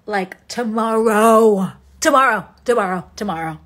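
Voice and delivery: emphatic impatient "tomorrow"; drawn-out tomorrow. Real, not acted. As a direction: stretch "tomorrow" long and falling, then rattle "Tomorrow, tomorrow" off. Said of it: "It needs to start".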